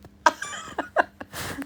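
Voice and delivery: very high, breathless laughter; high cackling laugh. Real, not acted. Laughing at own pretend heart attack, high cackle.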